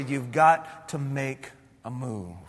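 a near-murmur, low and slow; still small voice. Acted. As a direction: hush it down to a gentle, slow urging. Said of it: "a still small voice".

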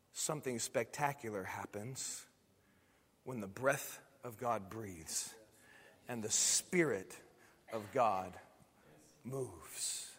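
hushed refrain. Shown as a demonstration. Pastor closing the sermon to his church, hushed. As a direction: let it sink in, almost under the breath. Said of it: "refrain repeated, hushed".

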